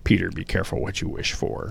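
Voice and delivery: hushed, whispered imitation of Doctor Strange; a soft, whispered mock Cumberbatch line. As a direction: breathe it like an ominous warning. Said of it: "It's Cumberbatch saying".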